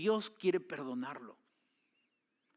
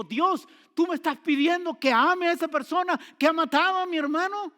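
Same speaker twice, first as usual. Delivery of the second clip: much higher, louder, indignant outcry; angry complaint to God; 12 dB louder than usual. Retold or imitated. Grieving brother rails at God, furious outcry. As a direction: hurl the question at God in anger. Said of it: "se enojó más y le dijo".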